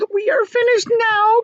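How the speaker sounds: high, loud wail; crying out in despair. Acted. Miss Spider despairing at the sharks, high wail. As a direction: wail it out in panic. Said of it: "cried Miss Spider".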